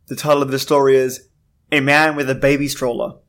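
ordinary delivery

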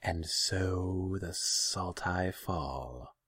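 echoing naga. A narrator's reading. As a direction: echo the omen, stretching each word. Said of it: "the Naga echoed".

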